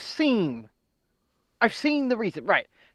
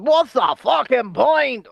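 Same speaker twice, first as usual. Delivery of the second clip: imitating John; 8 semitones higher, 5 dB louder, slower than usual. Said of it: "He went".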